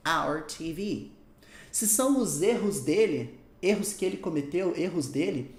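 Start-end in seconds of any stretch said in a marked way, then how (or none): 0.1-1.1 "our T V" said slowly, word by word; slow model phrase. Shown as a demonstration. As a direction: spell out "our T V" slowly for learners. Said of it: "é nossa". ("our T V" devagar, palavra por palavra)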